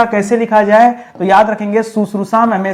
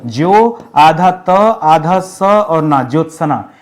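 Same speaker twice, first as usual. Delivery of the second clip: each part of "Jyotsna" said slowly and apart; slow spelling out; 3 semitones lower, slower than usual. Shown as a demonstration. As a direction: slowly spell out half t, half s, then na. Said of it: "Jyotsna में जो त".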